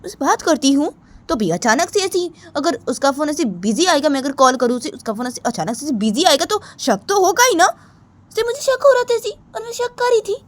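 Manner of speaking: a hint of a sulky girl justifying herself; Ritu explaining herself. Ritu telling why she doubted Sneha, lightly defensive.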